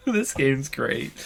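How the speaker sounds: slow words squeezed out through laughter; amused, breathless remark; laughing through words. Real, not acted. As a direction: talk while still laughing. Still laughing.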